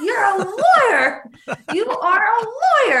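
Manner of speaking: very high, slow mock scream; retold screaming accusation. Retelling the Salem-like accusations, mock accusing scream. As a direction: stretch it out like a shrieking accuser. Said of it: "they started screaming".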